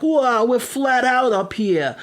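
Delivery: high, slow workmen in mock New York accent; bad New York accent. Imitating the workmen, bad New York accent. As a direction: exaggerate a terrible New York accent, stretched out. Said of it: "they were all like".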